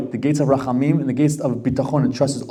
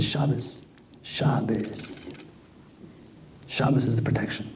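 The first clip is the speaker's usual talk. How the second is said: hushed; 3 semitones lower, 11 dB quieter, slower than usual. Retold or imitated. Grandmother whispers the key word.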